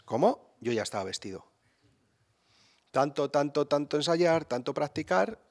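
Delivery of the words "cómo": higher, louder mock astonishment; a sudden startled question; mother amazed her son is already dressed; surprised mother.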